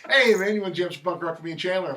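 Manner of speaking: high, loud, quick and eager; imitating Ron inviting him to jam; Ron's invite. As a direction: rattle off the offer like it's no big deal.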